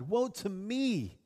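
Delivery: slow, stretched-out woe; cried lament. Acted. Preacher acting Isaiah's grief and sorrow, slow lament. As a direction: draw it out as an acted cry of grief. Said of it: "I cried".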